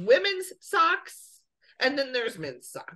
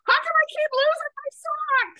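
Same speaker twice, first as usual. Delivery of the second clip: confused stranger; 14 semitones higher, faster than usual. Pretend. Voicing imagined confused guy.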